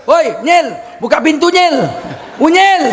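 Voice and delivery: loud high mocking shout; rude yell. Husband loudly mocking his wife at the door. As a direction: holler it rudely.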